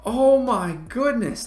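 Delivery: very high, stretched disbelieving cry; shocked. Real, not acted. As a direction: gasp it out, stunned. Shock at opponent's shot.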